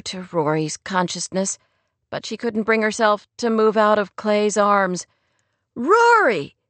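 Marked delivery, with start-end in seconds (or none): 5.7-6.6 much higher, louder, thrilled Rory!; excited cry. A narrator's reading. Teenager spotting Rory back home, excited cry. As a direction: call out Rory! in delight. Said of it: "Skip cried, his voice high and excited".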